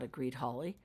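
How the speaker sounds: ordinary delivery, nothing marked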